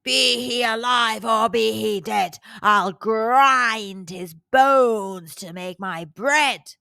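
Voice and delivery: slow, loud giant boom. A narrator's reading. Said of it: "the giant boomed".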